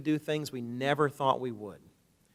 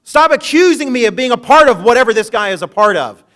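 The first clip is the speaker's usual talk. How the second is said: much higher and louder, furious; cursing denial; 9 semitones higher, 14 dB louder than usual. Retold or imitated. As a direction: rage at the accusers to get rid of them. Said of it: "cussing and calling down curses".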